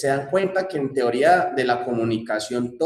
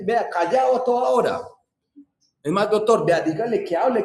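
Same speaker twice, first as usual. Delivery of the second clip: complaining wife; 7 semitones higher than usual. Retold or imitated.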